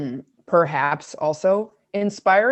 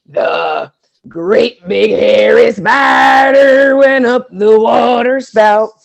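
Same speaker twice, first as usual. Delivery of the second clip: loud gravelly sung growl; big gravelly voice; 2 semitones higher, 9 dB louder than usual. Shown as a demonstration. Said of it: "You gotta do a big gravelly voice like that".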